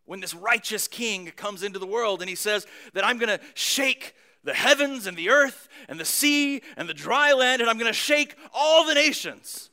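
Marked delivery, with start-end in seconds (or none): 3.0-9.7 loud, raised prophetic proclamation; booming voice. Retold or imitated. Preacher voices Haggai's prophecy from Handel's Messiah, booming. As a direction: thunder it out like a divine warning. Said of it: "where this deep, booming voice".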